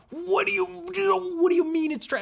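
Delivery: an offended mother cutting in high and sharp; mom getting mad. Retold or imitated. Mom reacting to her video called trash, high indignant outburst. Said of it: "so she got really mad".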